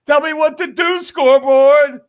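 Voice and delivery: high yelling at the scoreboard; high mocking yell. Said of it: "Mando would actually yell out".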